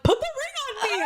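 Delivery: high, slow squealed plea; mock-squeal of eagerness; excited demand. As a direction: act out giddy impatience, very high. Retelling her excited demand.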